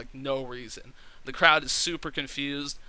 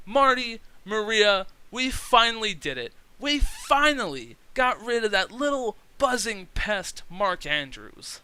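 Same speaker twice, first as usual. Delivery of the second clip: louder, higher smug gloating, slowing at the end; Bennett's gloating promo; 6 semitones higher, 4 dB louder than usual. Retold or imitated. Bennett gloating to his partners, louder and higher, smug. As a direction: gloat like a heel on the mic. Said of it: "Bennett goes".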